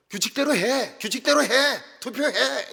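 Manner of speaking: mock demands in standard Seoul Korean; Seoul-accent quarrel. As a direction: imitate Seoul speakers fighting, high and loud. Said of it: "서울 사람들은 서울말로 싸우는 겁니다".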